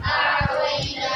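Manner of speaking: आतोइना drawn out slowly in recitation tone; recited word. Shown as a demonstration. Teacher recites आतोइना slowly for the class. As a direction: stretch आतोइना slowly, lengthening the vowels. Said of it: "क्या लिखा हुआ है".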